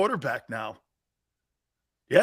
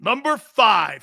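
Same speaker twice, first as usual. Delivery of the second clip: slow, drawn-out ranking reveal; countdown announcer; 6 semitones higher, 3 dB louder, slower than usual. Acted. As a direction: build suspense on each number.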